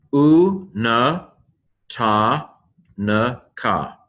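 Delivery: "U na ta na ka" in separate, held syllables. Shown as a demonstration. Teacher sounding out Hebrew for learners, syllable by syllable. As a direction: sound it out, pausing after each piece. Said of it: "the Hebrew pronunciation".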